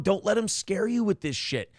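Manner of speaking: usual speaking voice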